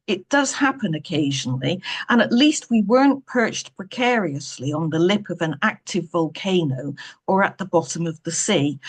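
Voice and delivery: ordinary delivery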